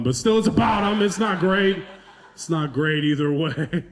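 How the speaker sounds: normal talking voice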